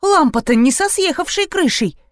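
high, alarmed exclamation; trembling fright. A narrator's reading. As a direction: exclaim in mild panic. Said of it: "затряслась Юлечка". (испуганно, высоким голосом)

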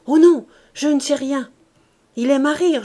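loud, high protesting cry; exclaiming. A narrator's reading. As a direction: cry out in denial. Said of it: "elle se récria".

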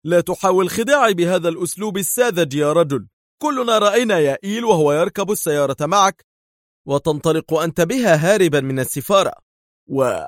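high, heated retort; vehement, insistent outburst; sharp. A narrator's reading. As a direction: accuse him sharply. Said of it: "قال دار في حدة".

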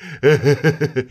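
put-on tipsy he-he chuckle; repeated mock snickering; imitated husband's laugh. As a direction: mimic a drunk man's chuckle. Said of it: "Cái giọng cười y chang".

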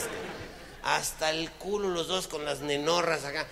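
ordinary delivery

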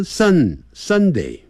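"sun" stretched slowly before Sunday; slow correct Sunday. Shown as a demonstration. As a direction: draw out sun, then Sunday. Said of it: "好，Sunday". (拉长的sun，Sunday)

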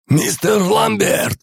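high, slow call from afar. Acted. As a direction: call down the corridor. Calling from the corridor.

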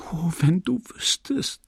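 urgent breathless whisper. Acted. Emma whispers to Rodolphe in the dark, urgent whisper. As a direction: whisper it so no one hears. Lovers meet secretly at night.

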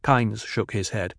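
ordinary delivery, nothing marked

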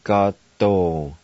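Gato said as one word, k-sound, stress ga; Cherokee word. Shown as a demonstration. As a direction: blend Gato smoothly as KAH-toh.

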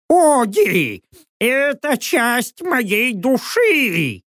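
high, slow lament; drawn-out, high-pitched anguished owl; wailing owl. A narrator's reading. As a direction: plead as if losing part of yourself. Owl laments, told to stop wailing.

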